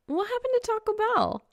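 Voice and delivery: put-on light teasing girl voice; Reaper's voice. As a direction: voice the girl character up high. Female character, very high.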